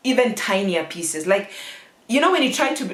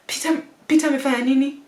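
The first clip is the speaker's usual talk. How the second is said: high, rapid-fire panicked questions; alarmed questioning that climbs higher and higher; dad on overdrive; 7 semitones higher, much faster than usual. Retold or imitated. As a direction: imitate a frantic father firing off guesses. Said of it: "his mind is just on overdrive".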